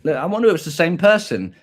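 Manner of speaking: ordinary talk, nothing marked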